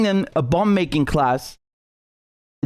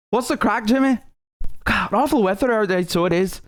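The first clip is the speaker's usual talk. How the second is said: higher-pitched mock Belfast greeting; Belfast accent; 4 semitones higher than usual. Imitating the guy from Belfast for viewers, mock Belfast accent. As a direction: put on a Northern Irish Belfast lilt. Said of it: "That is a fucking Belfast accent".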